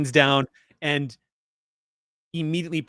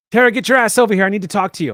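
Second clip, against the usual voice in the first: loud, high bossy summons; calling out; 8 semitones higher, 7 dB louder, faster than usual. Acted. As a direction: yell for someone to come over at once. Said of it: "She gives a call out".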